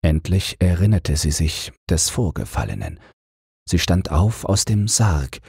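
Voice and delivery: ordinary delivery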